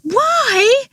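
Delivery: very high shrieked cry; high piercing shriek of frustration. A narrator's reading. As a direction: shriek the word back at him. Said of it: "she shrieked".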